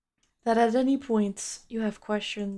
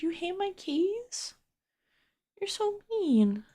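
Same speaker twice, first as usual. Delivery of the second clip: higher, slow pouty whine; mock hurt; 10 semitones higher than usual. Streamer pouts at a chatter who hates her keyboard, mock hurt and whiny. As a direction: play wounded, drawing it out high. Chatter says they hate keys.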